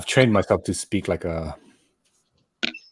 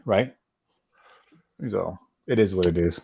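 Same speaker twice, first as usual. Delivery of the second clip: ordinary talk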